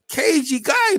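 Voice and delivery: cagey guy laughed out, pitch jumping up; laughing. Real, not acted. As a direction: giggle through cagey guy. Laughing about the cagey fighter.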